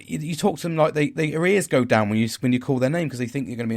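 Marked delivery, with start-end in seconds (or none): none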